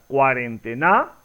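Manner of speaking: "cuarentena" stressed on NA, cuarenteNÁ, rising; questioning "cuarentena" accented on final NA; misplaced final stress. Shown as a demonstration. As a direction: push the last syllable of "cuarentena" up as a question. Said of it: "Es la pronunciación correcta".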